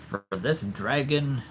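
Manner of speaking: ordinary talk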